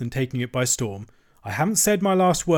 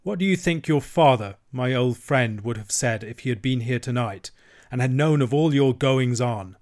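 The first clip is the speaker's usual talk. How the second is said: usual speaking voice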